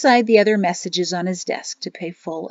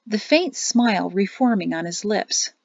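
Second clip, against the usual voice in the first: ordinary delivery, nothing marked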